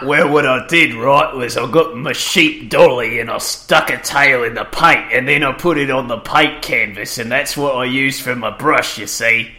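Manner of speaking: put-on broad Australian accent, teasing; mock Australian accent. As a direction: put on a thick Australian accent to rib your friend. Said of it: "That's how I talk".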